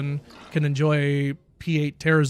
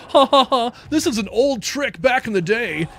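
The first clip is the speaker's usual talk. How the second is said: mocking smug player; 5 semitones higher than usual. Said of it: "You're like".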